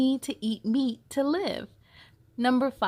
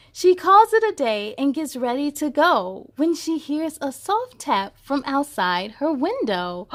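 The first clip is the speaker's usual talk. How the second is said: storybook narration; 2 semitones higher, 5 dB louder than usual. A narrator's reading. Reading a vet story aloud to kids, lively storybook narration. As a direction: read it like a bedtime picture book.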